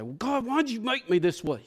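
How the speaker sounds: mock-mad complaint to God; retold anger at God. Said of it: "I get mad at him sometimes".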